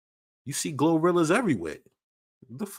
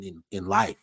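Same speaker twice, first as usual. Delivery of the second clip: ordinary talk, nothing marked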